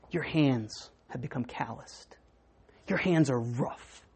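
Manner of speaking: tender God. Acted. God speaks slow and tender.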